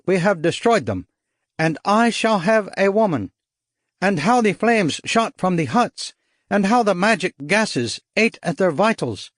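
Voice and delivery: high, giddy triumph; jubilant. A narrator's reading. Dong's jubilant victory dance.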